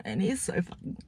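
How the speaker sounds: hushed, savouring whisper. Real, not acted. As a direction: whisper it as a gushing aside. Gushing aside about new man.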